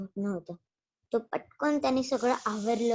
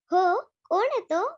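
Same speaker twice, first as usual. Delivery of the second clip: curious bee; 7 semitones higher, 5 dB louder than usual. Acted.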